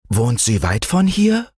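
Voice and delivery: put-on keen little child; breathless curiosity. A narrator's reading. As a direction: probe with a touch of breathless excitement. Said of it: "forschte Fritz atemlos".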